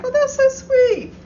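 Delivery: high, slow gushing; delighted. Retold or imitated. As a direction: gush with delight, pitched high. Acting her own delighted reply.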